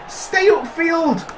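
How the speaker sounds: slow, high call to the keeper; slow high plea to stay upfield; drawn-out call. Real, not acted. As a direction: stretch it out, pleading.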